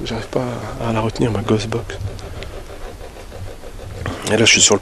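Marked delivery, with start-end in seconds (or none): 0.0-2.0 much softer muttering to self. Real, not acted. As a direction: grumble under the breath. Mutters about device.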